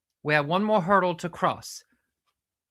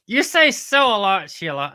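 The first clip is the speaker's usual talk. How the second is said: teasing sidekick; 4 semitones higher, 8 dB louder than usual. Acted. Sidekick teases her verbal habit.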